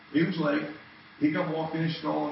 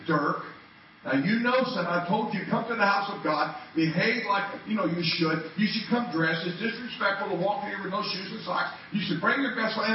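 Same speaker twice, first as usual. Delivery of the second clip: scolding lecture; 7 semitones higher, 5 dB louder than usual. Retold or imitated. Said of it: "I tore into him".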